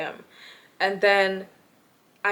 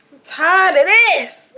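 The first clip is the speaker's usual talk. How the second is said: louder, higher, slow and drawn out; fed up; 8 semitones higher, 10 dB louder, slower than usual. Retold or imitated. Fed up with the housemates, loud and drawn out. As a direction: let it all out, done with it. Thinking in own head, fed up.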